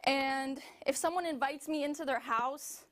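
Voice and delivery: normal talking voice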